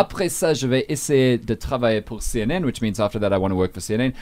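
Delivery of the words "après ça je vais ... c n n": the full French sentence read with clean pronunciation; French demo. Shown as a demonstration. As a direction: model the French sentence for the listeners. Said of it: "I'll give you a sense".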